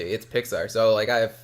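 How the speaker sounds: usual speaking voice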